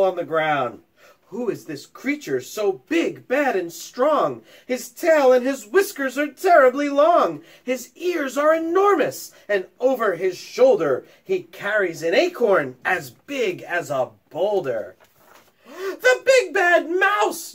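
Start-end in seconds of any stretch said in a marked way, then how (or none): 1.3-15.0 put-on awed, frightened child. 15.7-17.5 high, slow, loud scream of fright; terrified yell. Acted. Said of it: "yelled the Gruffalo's child".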